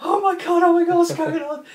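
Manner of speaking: squealing high-pitched panic; high girly panic. Retold or imitated. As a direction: shriek it like a scared girl. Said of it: "And she's like".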